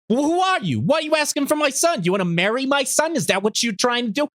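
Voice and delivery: high-pitched suspicious mother, hammed-up; high, pushy cartoon mother interrogation; Chi-Chi. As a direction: grill a stranger in a screechy mock-mom voice. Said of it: "that's what Chi-Chi sounds like".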